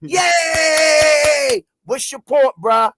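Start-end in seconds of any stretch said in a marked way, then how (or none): 0.0-1.6 long, high mock yay; sarcastic cheer. Capping his gripe about a friend, drawn-out sarcastic cheer. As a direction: drag out a fake hurrah.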